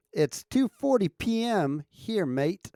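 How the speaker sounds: high, slow, shaky-footed Aussie accent attempt. Streamer tries out an Aussie accent for chat, amateur Australian. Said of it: "I lost my Aussie accent".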